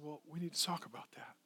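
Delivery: barely-voiced whisper, leaning in close; hushed whisper. Pastor quietly whispering to his worship leader. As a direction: breathe it like a private word.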